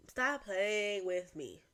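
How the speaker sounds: slow, stretched-out words; slow-motion playful telling-off; drawn-out warning. Pretend. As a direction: drag out each word deliberately. Repeats the phrase, second drawn out.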